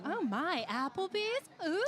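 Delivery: high, amazed mock wonder; mock awe. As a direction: gasp at it like it's luxury. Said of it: "mocking a fancy date".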